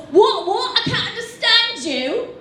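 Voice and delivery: high, indignant British customer; British accent. Acted. Customer dismissing the coworker's request, indignant British accent. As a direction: put on a British accent, baffled and rude. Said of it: "there's gonna be an accent".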